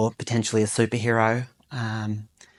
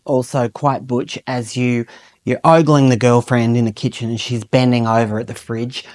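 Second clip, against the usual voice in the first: ordinary talk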